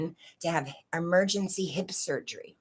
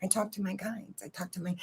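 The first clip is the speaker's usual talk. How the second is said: put-on much softer, a shy little kid explaining; small child answering; 2 semitones higher, 8 dB quieter than usual. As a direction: say it quietly like a child confessing. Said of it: "I said".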